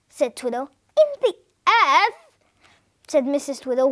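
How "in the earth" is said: high, rising echo question; incredulous. A narrator's reading. Wife wondering if her husband has gone mad, high incredulous question. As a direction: repeat it back, pitched up in disbelief. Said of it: "wondering if Twiddle had really gone mad".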